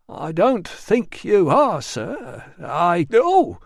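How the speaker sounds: politely refuse, then get grabbed; mild attendant, cut off by surprise; lightly voiced attendant. Attendant lifted off floor.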